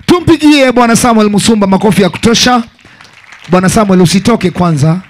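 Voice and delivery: loud party emcee shouting over music; hype MC. Acted. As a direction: pump up the crowd like a DJ host. MC hyping crowd over music.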